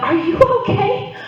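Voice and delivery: concerned teacher. Retold or imitated. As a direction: ask with mock concern. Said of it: "Teacher comes up".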